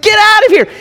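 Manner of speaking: high frantic shoo; Abram shooing. Pretend. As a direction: shout the birds off. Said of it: "he's like".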